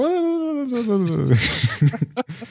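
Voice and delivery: a long, high laugh that rises. Real, not acted. Laughing while retelling Jin's angry Korean, high and long. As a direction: laugh hard at the memory.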